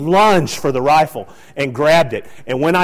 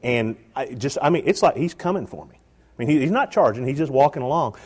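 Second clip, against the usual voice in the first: usual speaking voice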